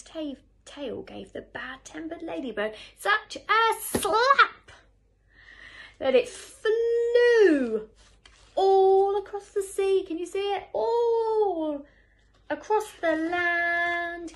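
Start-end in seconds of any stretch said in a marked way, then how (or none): 3.0-14.3 very loud, high, hugely drawn-out words; excited storyteller calling out across the distance; dramatic story climax. A narrator's reading. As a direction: stretch the key words as if following a long flight. Dramatic climax, ladybird slapped flying away.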